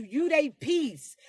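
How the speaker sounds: ordinary delivery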